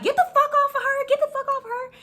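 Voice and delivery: high, shrill mock yell of the cousin. Cousin on the bus, yelling at the girls holding her down. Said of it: "my cousin was like".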